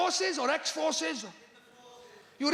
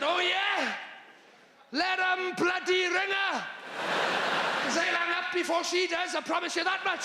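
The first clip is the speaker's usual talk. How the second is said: defiant scoff; 3 semitones higher than usual. Retold or imitated. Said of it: "And I said".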